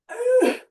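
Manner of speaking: high, strained "ugh"; sharp high grunt of effort; effort groan. Real, not acted. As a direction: groan with the exertion. Straining during the exercise.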